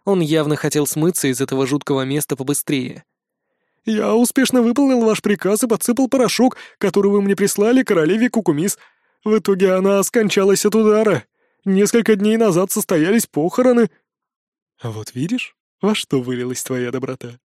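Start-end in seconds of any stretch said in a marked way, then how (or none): 3.8-13.9 higher, eager servile elf; fawning servant voice. A narrator's reading. 14.8-17.4 pleased smile. A narrator's reading. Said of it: "Довольно улыбаясь".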